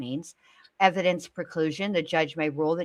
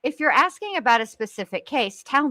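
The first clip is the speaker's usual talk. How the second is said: excited; 6 semitones higher, 6 dB louder than usual. Real, not acted. Joking about Google's search results.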